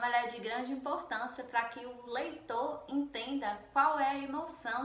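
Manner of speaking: normal talking voice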